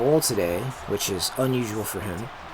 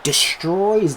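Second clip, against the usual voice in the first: loud, punchy, high-pitched commentary; excited praise; 5 semitones higher, 7 dB louder, slower than usual. Real, not acted. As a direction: cheer the defender on, leaning hard on each phrase. Praising a defensive play.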